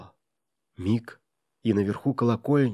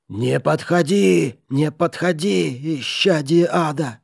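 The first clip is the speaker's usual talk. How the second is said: high, slow, louder panicked plea; terrified babbling; 5 semitones higher, 4 dB louder, slower than usual. A narrator's reading. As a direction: beg in mortal terror. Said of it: "в смертельном ужасе лепетал".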